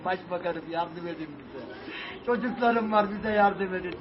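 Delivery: real despair, close to tears; broken, tearful pleading. Real, not acted. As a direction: plead quietly as if all strength is gone. Exhausted man quietly begging, near tears.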